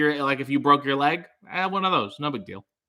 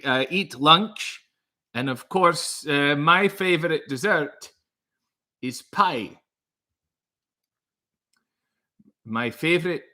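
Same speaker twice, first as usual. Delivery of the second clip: bad Scottish accent. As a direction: do awkward classroom stand-up in a bad Scottish accent. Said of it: "my bad attempt at a Scottish accent".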